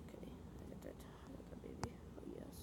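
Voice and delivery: breathy whisper, no voice at all; hushed whisper. Real, not acted. Streamer whispering while fixing a setting, hushed. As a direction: whisper it so the mic barely catches it.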